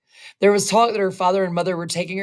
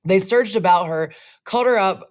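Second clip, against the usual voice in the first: plain reading voice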